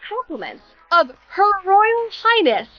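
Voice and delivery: high, ceremonious quote; mock-grand note reading. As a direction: read it out like a royal proclamation. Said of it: "Zoom in here and it says".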